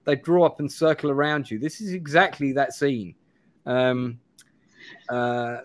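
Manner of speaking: normal talking voice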